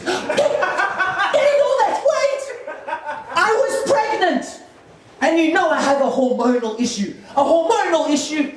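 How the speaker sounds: high-pitched offended wife in a mock quarrel; indignant wife. Wife answers husband's jab about weight, acted indignant high voice.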